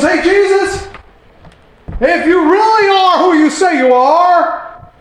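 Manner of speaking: Satan mocking Jesus, high and sneering. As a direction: taunt Jesus like a smug devil. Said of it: "He goes".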